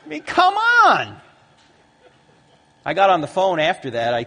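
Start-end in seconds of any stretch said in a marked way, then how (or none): 0.0-1.2 loud, high, drawn-out protest; exasperated disbelief. Acted. Preacher scoffing at the commentator's theory, loudly incredulous.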